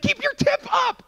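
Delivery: very high, loud coaching yell; urgent shout. Retold or imitated. Buddy coaching him during the fight, urgently shouted. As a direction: holler the instruction in a frantic high voice.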